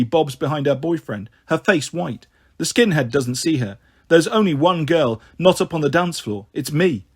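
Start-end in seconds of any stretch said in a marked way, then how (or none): none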